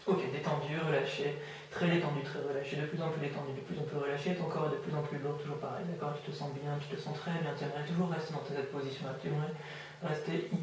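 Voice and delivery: soft, soothing hypnotist patter; hypnotic induction. Real, not acted. (voix douce d'induction hypnotique)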